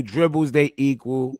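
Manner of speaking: ordinary delivery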